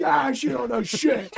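high, loud, slow dismissive yell; mock shout. Shrugging off his friend about grandma, high loud shout. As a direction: shout it off like a cocky kid. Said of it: "I'm like".

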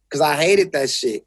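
usual speaking voice

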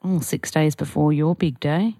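normal talking voice

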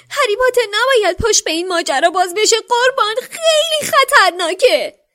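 very high, loud elf cry, slowing into sobs; terrified warning that ends in tears; frightened elf. A narrator's reading. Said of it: "با تته پته گفت".

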